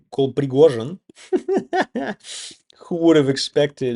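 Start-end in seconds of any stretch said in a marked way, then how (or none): 1.3-2.5 high-pitched chuckle; amused laugh. Real, not acted. Laughing at the turn in Prigozhin's story, high amused chuckle.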